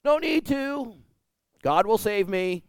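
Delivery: high, confident turning down of help; smug refusal. Retold or imitated. Drowning man refusing the boat, smugly confident. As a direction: wave off the help, sure of God. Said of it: "the guy goes".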